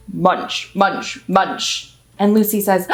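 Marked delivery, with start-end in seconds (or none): 0.1-1.9 giraffe munching. Retold or imitated. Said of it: "the giraffe goes".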